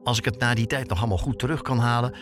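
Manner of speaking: ordinary talk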